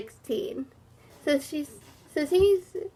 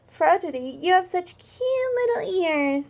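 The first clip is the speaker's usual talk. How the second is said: friendly compliment; 7 semitones higher than usual. Acted. Friend's compliment voiced in story.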